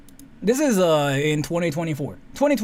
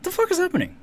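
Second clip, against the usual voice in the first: sudden high-pitched shocked outburst; a high, startled what-the-hell; shocked exclamation; 13 semitones higher, faster than usual. Retold or imitated. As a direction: blurt it out, pitch shooting up in disbelief. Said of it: "And I was like".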